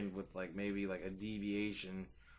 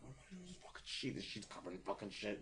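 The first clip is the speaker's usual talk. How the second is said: softer and quick, rising on my God; hushed panic; 10 dB quieter than usual. Retold or imitated. Panicking over the soiled sheet, quietly. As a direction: freak out under your breath.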